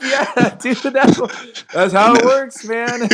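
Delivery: amused dad laughing while he answers. Retold or imitated. Dad laughing retelling reply.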